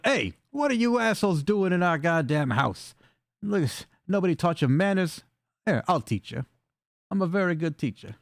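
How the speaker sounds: affronted goblin. Acted.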